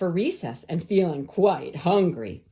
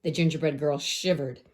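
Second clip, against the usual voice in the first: plain reading voice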